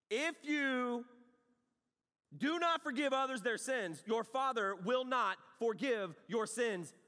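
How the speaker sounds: high and loud, slow, hammering every word; preaching at full force. Acted. Preacher warning the congregation about unforgiveness.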